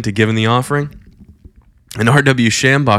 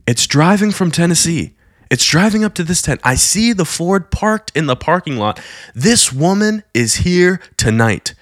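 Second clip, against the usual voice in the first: raised, breathless visionary voice, higher; rapt vision report; 5 semitones higher than usual. Retold or imitated. Preacher tells thousands what he sees, in a higher awed voice. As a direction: describe the vision as it unfolds, awed.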